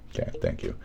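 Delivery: very soft, low sign-off; hushed thanks. Real, not acted. Streamer closing a rant to viewers, suddenly hushed. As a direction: drop right down to a near whisper. Abrupt hushed close after ranting.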